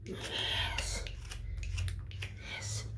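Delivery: breathy whisper; hushed whisper; whispered yes. Real, not acted. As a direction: whisper it almost silently. Whispered aside.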